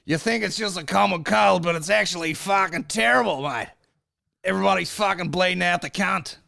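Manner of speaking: put-on loud, high Australian accent; hammed-up Australian documentary narrator; mock Australian. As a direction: shout it in broad mock Australian. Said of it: "the Australian ones are classic".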